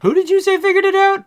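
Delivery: high, fast disbelieving squeak; incredulous boss. Retold or imitated. Boss squeaking in disbelief at who solved it. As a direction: voice Morgan Freeman shooting way up in disbelief. Morgan Freeman doubting, retold.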